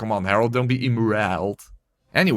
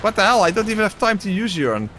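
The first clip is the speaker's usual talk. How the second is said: higher, louder frantic outbursts; panicked shouting; 8 semitones higher, 3 dB louder than usual. Real, not acted. Player panicking as the bomb goes off. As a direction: yell in alarm at the game.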